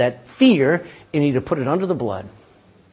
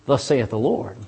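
calm rebuke; 6 semitones lower, 3 dB quieter, slower than usual. Retold or imitated. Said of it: "you just look at him and you go".

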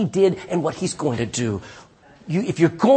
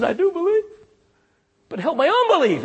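desperate father; 11 semitones higher than usual. Retold or imitated. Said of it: "he says".

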